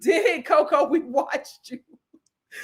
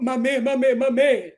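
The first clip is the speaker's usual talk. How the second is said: mimicking Coco; 3 semitones lower than usual. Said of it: "That's what you said".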